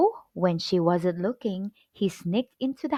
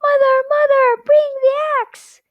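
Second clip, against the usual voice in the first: very high, loud desperate yell; frantic yell down to his mother; 9 dB louder than usual. A narrator's reading. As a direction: scream for mother while fleeing the giant.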